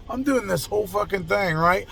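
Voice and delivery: normal talking voice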